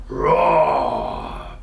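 long, drawn-out storybook roar; big voiced beast bellow; bellowed dragon roar. A narrator's reading. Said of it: "bellowed the dragon".

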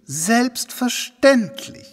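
slow, drawn-out and high, then falling; a lofty, slow matter-of-course drawl; condescending. Acted. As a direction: stretch "Selbstverständlich" out as if it's obvious. Host answers question condescendingly.

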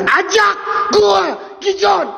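high shouted summons; ritual shout. Acted. Ghost hunter summoning the spirits to play, shouting. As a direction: call the spirits out, high and slowed.

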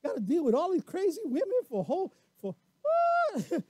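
high, incredulous imitation; mock exasperated wife. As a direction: squeak out the disbelief. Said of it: "she could have said".

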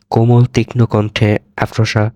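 ordinary delivery

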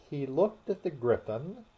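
usual speaking voice

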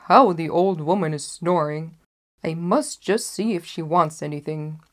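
a hunter's hearty muse to himself; gruff huntsman. Acted. Huntsman passing hears snoring.

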